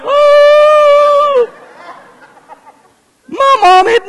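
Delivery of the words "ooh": put-on very high, loud childish ooh; a small boy stunned; gasping ooh in a little boy's voice; shocked kid's ooh. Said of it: "And I went".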